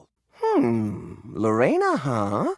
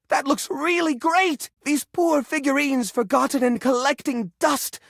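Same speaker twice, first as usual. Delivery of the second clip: delighted; 6 semitones higher than usual. Acted. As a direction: gush over the sculpture. Admiring the finished sculpture.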